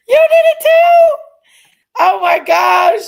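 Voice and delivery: overjoyed amazed cry, slowing on the last words; thrilled disbelief. Real, not acted. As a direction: shriek it in delighted shock. Viewer gives more memberships.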